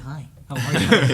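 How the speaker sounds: loud, slow, laughing "Hi, how are you"; cartoon-cheery greeting through a laugh; SpongeBob voice. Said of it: "the SpongeBob episode where he's like".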